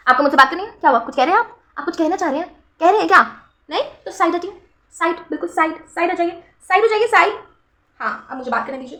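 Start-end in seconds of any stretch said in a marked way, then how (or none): 0.1-7.3 sassy shooing. Pretend. Shooing someone off-camera.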